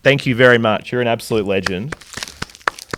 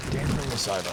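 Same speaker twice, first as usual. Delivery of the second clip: much softer, half to himself; quiet repeat; 12 dB quieter, faster than usual. Real, not acted. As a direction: drop the voice, say it to yourself.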